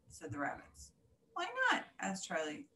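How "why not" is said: high, hurt question; surprised. Acted. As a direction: squeak it out in surprise. Caterpillar asks, rejected.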